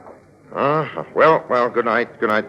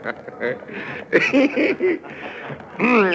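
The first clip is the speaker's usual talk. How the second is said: high, helpless burst of laughter; long high amused laugh. Acted. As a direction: laugh at the mark you can't hold in. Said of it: "I shouldn't be laughing".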